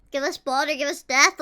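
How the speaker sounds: normal talking voice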